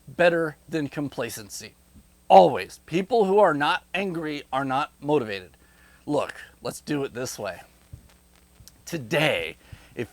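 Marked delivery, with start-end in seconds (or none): none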